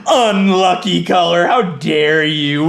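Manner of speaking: high, loud, offended crowing; hammed-up "how dare you" outrage; mock indignation. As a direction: throw the insult back, stretching the words. Gloats back at the insult.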